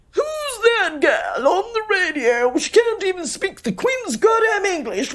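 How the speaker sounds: high, slow, indignant mock-posh complaint; outraged posh caller. Caller phoning the radio to complain about her accent, mock outraged. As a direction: sneer it out like an offended listener. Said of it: "he literally said".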